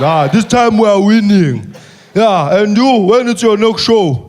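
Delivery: put-on slow, loud, heavy-accented; Malema impression. Malema greeting the comedian, slow and loud. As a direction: drag each word, teasing. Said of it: "He says".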